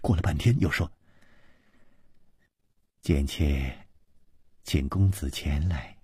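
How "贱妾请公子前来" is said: low, very soft, humble request; sweetly smiling. A narrator's reading. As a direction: ask delicately, keeping the voice hushed. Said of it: "王妃嫣然一笑". (嫣然含笑，低柔相求)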